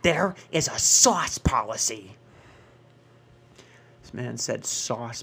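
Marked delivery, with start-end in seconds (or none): 0.0-2.2 slow, deliberate rule-quoting voice; stern manager. Retold or imitated. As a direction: lay down the law slowly. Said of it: "And he goes".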